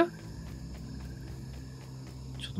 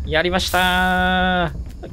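drawn-out cheer; 4 semitones higher, much slower than usual. Real, not acted. Celebrating the escape.

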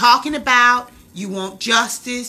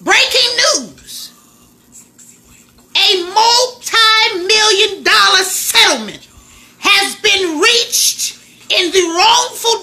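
slow, high, drawn-out breaking-news announcer; mock news read; 4 semitones higher, slower than usual. Streamer announcing the settlement to viewers, mock news anchor read. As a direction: play the anchor reading a headline, slowly.

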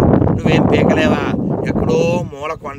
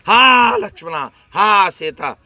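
high, drawn-out mock cry for help; mimicking Rama's voice; 5 semitones higher, 4 dB louder, much slower than usual. Maricha tricking Lakshmana with Rama's voice, imitated anguished call. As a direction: call out long and loud, imitating someone in distress. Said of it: "మరి ఆడు రాముడు గొంతు".

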